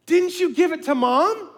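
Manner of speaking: high, leading question; hammed-up innocent question. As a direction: pretend not to know. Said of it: "I'm gonna ham this up".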